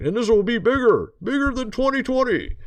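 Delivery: lightly hammed-up villain warning, pitched high; a half-hearted villain threat, pitch jumping up; badly faked villain, high and emphatic; mock villain voice. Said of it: "pretend I'm making a villain voice there".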